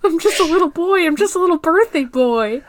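mock childish voice. Riffing on the Puck voice.

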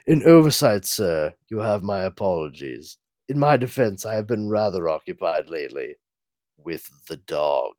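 a hint of a dry proper butler; lightly voiced butler. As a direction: apologize stiffly like an old servant. Alfred's dry apology.

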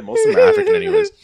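high, fast laughing aside. Real, not acted. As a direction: blurt it out while laughing.

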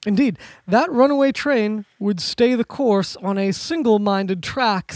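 ordinary talk, nothing marked

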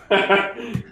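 short high burst of laughter. Real, not acted. Big laugh.

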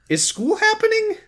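voix aiguë de gamin qui se plaint; whiny kid. Retold or imitated.

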